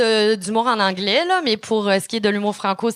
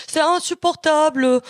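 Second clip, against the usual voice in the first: higher, slow put-on French accent on "insupportable"; 5 semitones higher than usual. Shown as a demonstration. Comic slipping into French from France, mock French accent. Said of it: "je prends l'accent français".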